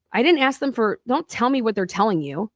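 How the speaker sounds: ordinary talk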